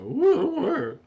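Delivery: mock a voice crack, leaping high and loud; exaggerated squeaky crack on the word; imitated voice crack. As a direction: imitate your own voice suddenly jumping up. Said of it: "Did you hear my voice just go".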